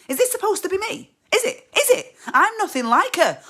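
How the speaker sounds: very high, loud, insistent demand; indignant confrontation. Acted. Wife asking husband if clingy character is her, indignant. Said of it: "I asked him straight out".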